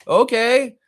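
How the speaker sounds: high, loud exaggerated okay; sarcastic okay. Real, not acted. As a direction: overplay the disbelief. Reacting to imagined reply.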